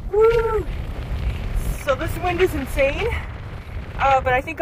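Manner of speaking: shouting over wind. Real, not acted. Windy summit.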